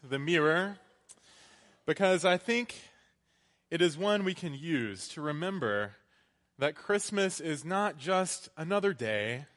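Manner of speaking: energetic sermon delivery; preaching. Acted.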